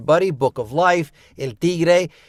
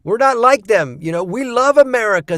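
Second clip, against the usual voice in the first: high, loud, insistent protest; emphatic mock declaration, pitched up; proud Tex-Mex Latinos; 7 semitones higher, 5 dB louder than usual. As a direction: imitate Latinos insisting they're all-American. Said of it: "They're like".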